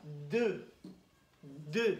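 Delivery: "deux" slowly, the initial D held and released; D sound demonstrated. Shown as a demonstration. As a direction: press the tongue on the D of "deux". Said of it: "J'ai la langue entre les dents". (« de » isolé, D bien appuyé)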